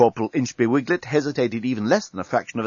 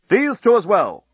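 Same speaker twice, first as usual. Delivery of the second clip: commanding; 9 semitones higher, 5 dB louder than usual. A narrator's reading. Captain orders two more arrested.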